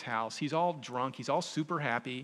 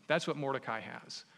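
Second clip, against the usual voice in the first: ordinary delivery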